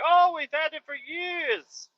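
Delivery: high, slow, put-on simple voice. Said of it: "He's like".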